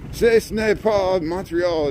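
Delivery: slow, careful mock French accent. Correcting viewers who say Montreal, in slow mock French. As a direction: spell it out slowly in playful French. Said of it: "We have to say it in French".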